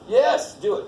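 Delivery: mild yell, egging them on. Real, not acted. Teacher urging the class on, mild yell. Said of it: "I don't know why I'm yelling".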